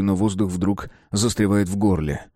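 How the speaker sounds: ordinary delivery, nothing marked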